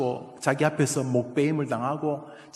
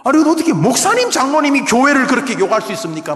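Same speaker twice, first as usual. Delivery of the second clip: fast, high and loud protest; indignant outburst; 10 semitones higher, 10 dB louder, faster than usual. Acted. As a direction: rattle off the outrage. Preacher voicing indignant believer.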